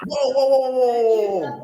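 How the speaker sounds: high, loud repeated whoas of alarm. Real, not acted. Friend reading a shocking post, loud alarmed whoas. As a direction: recoil in shock with rising whoas.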